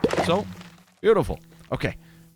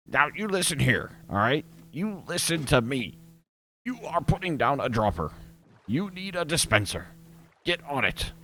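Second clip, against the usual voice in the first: higher, hammed-up lecture to the viewers; mock stern scolding; 6 semitones higher than usual. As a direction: wag a finger at the audience in a put-on voice.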